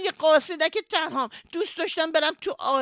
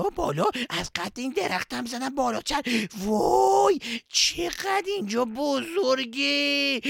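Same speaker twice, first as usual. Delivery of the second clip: ordinary talk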